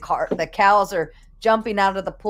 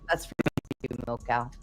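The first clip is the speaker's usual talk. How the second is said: ordinary delivery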